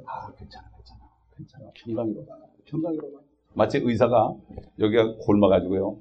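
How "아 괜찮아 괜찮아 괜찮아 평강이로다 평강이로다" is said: hushed, false reassurance; very soft imitation of empty soothing; mock soothing whisper. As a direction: whisper comfort like a false prophet. Mimics soothing false prophets excusing sin.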